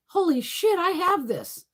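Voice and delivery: shocked realization. Retold or imitated. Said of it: "and I'm like".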